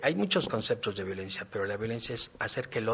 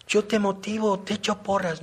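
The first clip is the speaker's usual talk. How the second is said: father's protest; 9 semitones higher, 7 dB louder than usual. Retold or imitated. Father's retold protest to daughter.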